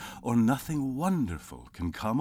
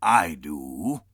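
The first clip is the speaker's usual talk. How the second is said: a louder curt reply; curt Scrooge; 8 dB louder than usual. Acted. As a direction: cut him off. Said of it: "said Scrooge".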